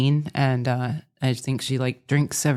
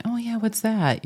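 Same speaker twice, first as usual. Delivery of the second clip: naive younger self; 8 semitones higher than usual. Retold or imitated. Retelling naive reply to invitation.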